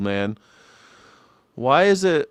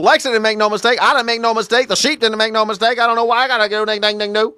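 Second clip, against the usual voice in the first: imitating country man; 6 semitones higher, faster than usual. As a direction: ham up the old farmer's accent. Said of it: "guys that talk like this".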